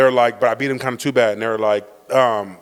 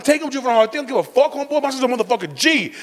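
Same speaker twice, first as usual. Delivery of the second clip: high, worked-up imitation of a defiant dad; 8 semitones higher, faster than usual. Dad telling police to take his son, mock defiance. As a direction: brag back at the cops. Dad defying officers, retold.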